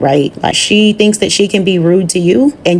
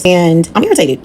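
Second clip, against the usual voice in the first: usual speaking voice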